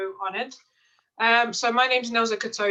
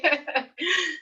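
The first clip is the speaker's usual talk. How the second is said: laugh; 9 semitones higher than usual. Real, not acted. Laughs after retelling.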